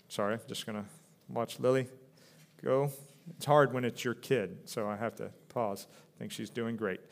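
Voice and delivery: low, soft aside mid-sermon; quiet parent's apology for breaking off; real hushed aside. Real, not acted. Breaks off to watch own child.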